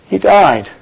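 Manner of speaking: ordinary delivery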